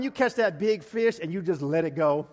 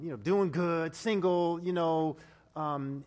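ordinary delivery

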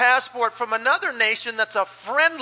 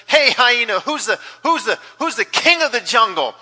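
loud, brash bully of a lion; lion barking at the lowly hyena; bossy lion; 4 semitones higher, 5 dB louder than usual. Acted. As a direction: demand an answer from the hyena.

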